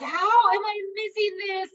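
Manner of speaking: high, slow squeak of disbelief; dismayed disbelief. Retold or imitated. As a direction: whine it like you can't believe you missed it. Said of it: "And I was like".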